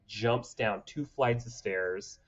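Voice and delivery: ordinary talk, nothing marked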